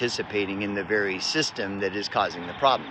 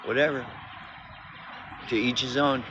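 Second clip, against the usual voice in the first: dismissive brush-off; 5 semitones higher than usual. Retold or imitated. Speaker brushing off defensive people, mock dismissive. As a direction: toss it off as if you couldn't care less. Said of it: "It's like".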